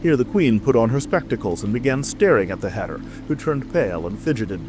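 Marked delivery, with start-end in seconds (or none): none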